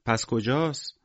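lightly voiced little girl asking aloud; calling question. A narrator's reading. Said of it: "با صدای بلند پرسید".

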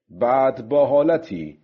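ordinary delivery

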